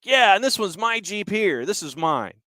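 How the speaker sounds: high, offhand claim on the Jeep; breezy officer claim. Retold or imitated. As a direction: toss it off like it's obviously yours. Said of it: "basically is like".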